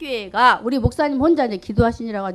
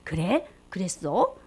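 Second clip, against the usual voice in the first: lower, gentle God's reply; a lightly voiced fatherly God; God answering; 7 semitones lower, 6 dB quieter, slower than usual. Preacher voicing God's kind answer to a praying child, lower voice. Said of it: "하나님이 그렇게 대답을 하겠죠".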